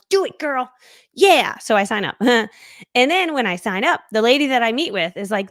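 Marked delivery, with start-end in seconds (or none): 0.1-1.6 self pep talk. Retold or imitated. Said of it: "So I'm like".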